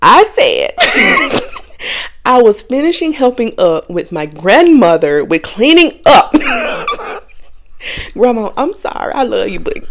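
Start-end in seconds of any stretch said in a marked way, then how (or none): 0.8-2.2 laugh. Real, not acted. Nervous laugh at own excuse. 6.4-8.1 laugh. Real, not acted. Laughing at own lie.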